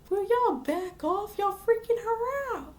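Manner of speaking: high-pitched, exasperated telling-off; mock scolding. Boy defends the girl from the crowd, mock scolding.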